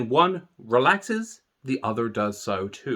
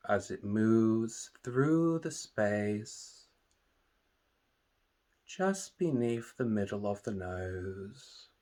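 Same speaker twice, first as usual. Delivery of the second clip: guided meditation; 2 semitones lower, 10 dB quieter than usual. Acted. Meditation teacher leading listeners through a body scan, calm and soothing. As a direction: calmly guide listeners into relaxation, unhurried and hushed.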